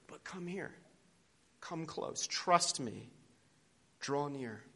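hushed and soft, drawing near; whispered invitation. Acted. Preacher voicing God inviting the listener, whispered. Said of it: "He's saying".

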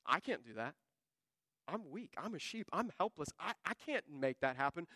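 soft, small admission of weakness; low-key, humbled and helpless; helpless confession. Acted. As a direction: confess it quietly, as if powerless. Voicing David's helpless prayer.

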